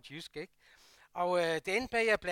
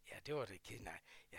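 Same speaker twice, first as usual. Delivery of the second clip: whispered, hushed reply; soft reply; 10 semitones lower, 16 dB quieter, faster than usual. Retold or imitated. Foreman quietly accepting the apology, whispered. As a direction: answer gently under the breath.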